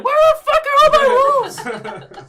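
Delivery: high, loud, furious shriek; angry yell. Retold or imitated. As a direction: scream it at the whole kitchen, slowly. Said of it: "Arin comes into the kitchen and she's like".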